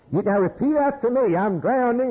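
mock the fussy soul winner. Mocks preacher making sinner repeat.

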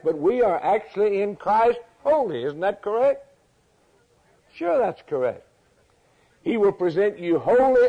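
high-pitched, driving pulpit delivery; impassioned preaching. Real, not acted. Preacher declaring holiness to the congregation, impassioned and high.

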